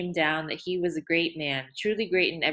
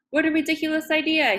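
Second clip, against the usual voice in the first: dry laugh; 10 semitones higher, 5 dB louder than usual. A narrator's reading. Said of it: "laughing so dryly it was like a cough".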